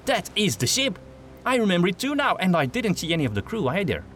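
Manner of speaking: a hint of a cocky merchant, lightly voiced; Gustav voice. A narrator's reading. Gustav recognizes the ship.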